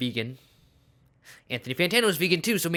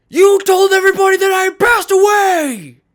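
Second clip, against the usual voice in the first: furious high-pitched yelling, hammed-up; Anthony Fantano mad; 14 dB louder than usual. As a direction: explode in an outraged, over-the-top shout. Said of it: "he gets freaking mad at me".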